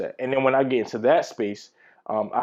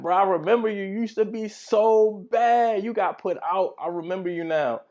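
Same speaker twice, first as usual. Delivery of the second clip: excited woman; 10 semitones higher than usual. Retold or imitated. Woman loudly recognises old classmate.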